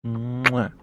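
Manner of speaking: loud smacking air kiss; kiss sound. Real, not acted. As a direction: blow a big kiss into the mic. Blowing a kiss to chat.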